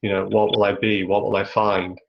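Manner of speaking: ordinary talk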